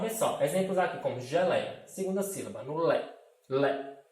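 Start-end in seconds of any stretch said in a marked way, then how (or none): 2.8-4.0 "lé" said twice, the E leaned on; teacher repeating a syllable for the class; syllable demo. Shown as a demonstration. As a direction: isolate "lé" and lean on the E. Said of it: "o E é muito mais forte".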